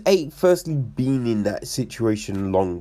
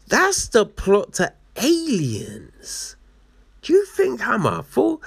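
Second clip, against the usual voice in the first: mock indignant witch; 8 semitones higher than usual.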